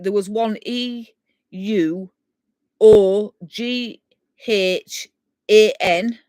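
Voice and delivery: letters spelled out one by one, with pauses; spelling out. Shown as a demonstration. Spelling a hard Scottish name for viewers, letters spelled out. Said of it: "When sounds like the word when".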